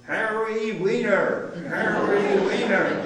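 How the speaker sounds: slow, high, loud imitated holler; yelling down. Soldier summoning a Yiddish speaker down the column, imitated yell. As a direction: call the name out long down the line. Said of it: "Yells down".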